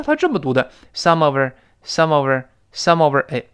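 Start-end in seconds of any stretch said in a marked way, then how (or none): 0.9-3.2 "some of our" run together as "some-over"; reduced, joined-up "some of our"; linked reading. Shown as a demonstration. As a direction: link "some of our" into one light "sum-ə-ver". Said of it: "注意这，它这么读的".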